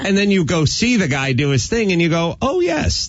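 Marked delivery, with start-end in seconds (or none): 2.4-3.1 much higher, acted lightbulb moment; dawning realization. Host acts out seeing a friend's real talent, high-pitched realization. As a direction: pitch it way up as if suddenly remembering.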